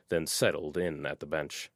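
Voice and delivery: ordinary delivery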